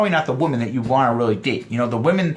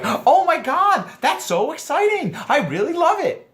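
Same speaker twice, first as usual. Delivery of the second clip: very high mock-feminine excitement; gushing social enthusiasm; 13 semitones higher than usual. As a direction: gush in falsetto, stretching the opening. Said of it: "expected you to be all social".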